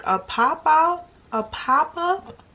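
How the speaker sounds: high, loud, slow disbelief; incredulous. Retold or imitated. Repeating his midnight invite back to him in disbelief. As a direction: stretch it out like it's absurd. Retelling her reply to him.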